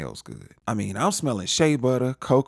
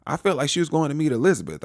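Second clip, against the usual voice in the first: ordinary delivery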